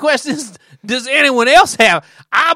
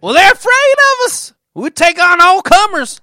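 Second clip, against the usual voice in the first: cocky bragging; 7 semitones higher, 5 dB louder than usual. Pretend.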